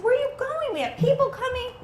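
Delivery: high exasperated question; alarmed wife. Retold or imitated. Wife calling after husband leaving before guests, high. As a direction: pitch up in disbelief. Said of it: "I said".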